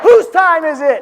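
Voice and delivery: high, loud, slow rally call; rally shout. Real, not acted. Speaker shouting to the crowd for Dave, at full volume. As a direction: roar it out to fire up the crowd.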